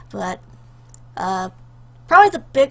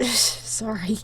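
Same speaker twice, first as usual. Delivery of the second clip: breathy laugh. Real, not acted. Embarrassed laugh after tearing up.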